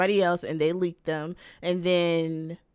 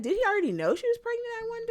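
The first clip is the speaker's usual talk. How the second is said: mock wonder; much faster than usual. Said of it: "and I was like".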